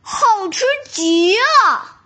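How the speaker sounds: slow, relishing mmm; drawn-out delighted tiger; savoring tiger. Acted. Tiger tastes candy.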